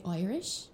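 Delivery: "Irish" with an "oy" opening; local pronunciation of "Irish". Shown as a demonstration. Showing a friend how they say it in Ireland, "Irish" as "Oyrish". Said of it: "We say Irish".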